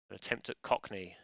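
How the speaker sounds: usual speaking voice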